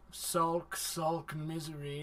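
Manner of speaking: soft, low moping; hammed-up gloomy sulk; mock sulk. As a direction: mope it out. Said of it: "he's just like".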